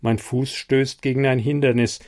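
ordinary delivery, nothing marked

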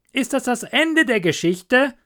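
lightly voiced rat, high question; inquiring water rat. Water rat asking the linnet about the story, inquiring. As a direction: ask it high and keenly.

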